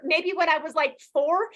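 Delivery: usual speaking voice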